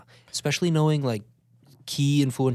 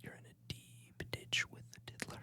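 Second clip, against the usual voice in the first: ASMR whisper; 3 semitones higher, 18 dB quieter than usual. Pretend. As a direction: barely breathe the words. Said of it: "doing A S M R".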